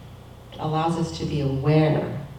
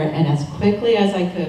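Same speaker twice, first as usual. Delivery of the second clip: normal talking voice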